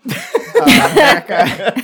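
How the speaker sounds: high, loud laugh through the words. Real, not acted. Player laughing at his own joke about a tax collector. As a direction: crack up mid-sentence.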